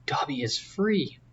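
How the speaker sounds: slow, hushed whisper of disbelief; awed whisper. Acted. House-elf to his old master, realising he is free, in a slow awed whisper. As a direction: breathe it out slowly as if it can't be true.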